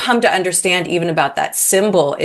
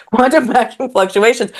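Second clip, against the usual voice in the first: amused, higher, laughing through the term; laughing repeat; 6 semitones higher than usual. Real, not acted. Guest laughing at her own physics tangent, amused. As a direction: chuckle at your own jargon.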